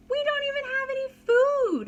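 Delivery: put-on high, whiny child's complaint; a little boy's high grumble; complaining. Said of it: "Chaim complained".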